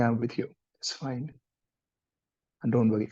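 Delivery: whisper-close reassurance to someone upset; soft comfort. Shown as a demonstration. Counsellor assuring a crying person, very softly. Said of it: "And say that".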